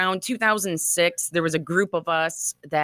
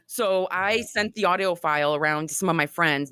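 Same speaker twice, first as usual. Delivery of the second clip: ordinary delivery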